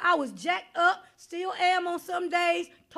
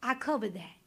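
much lower, softer, lightly voiced; Jesus voiced; 9 semitones lower, 6 dB quieter than usual. Preacher voicing Jesus at the judgment, low and gentle. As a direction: say it gently, settling the matter. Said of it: "Jesus is gonna stand in between and say".

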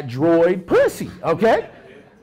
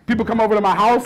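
normal talking voice

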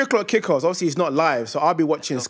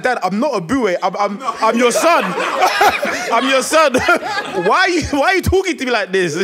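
higher, laughing, rushing complaint; laughing protest; 7 semitones higher, 4 dB louder than usual. Retold or imitated. Son protesting to the scolding dad, laughing. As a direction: plead through laughter.

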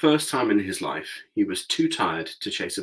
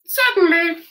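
very high, squeaky little mouse voice; squeaky mouse queen; 9 dB louder than usual. A narrator's reading. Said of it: "in her squeaky little voice".

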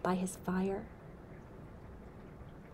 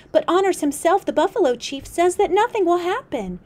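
higher and louder insistence; emphatic warning; 11 semitones higher, 9 dB louder, faster than usual. Acted. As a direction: press the chief's name hard. Otter Woman quotes the chief.

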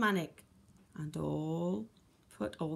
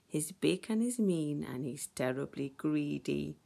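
plain reading voice

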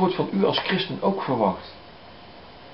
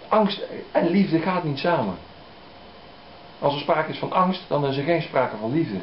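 ordinary talk

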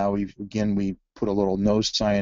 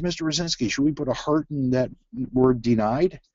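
student asking; 6 semitones higher than usual. Retold or imitated. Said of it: "He said".

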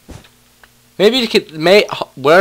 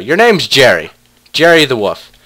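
usual speaking voice

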